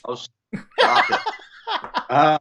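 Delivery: embarrassed laugh through the words. Real, not acted. Host reveals her research.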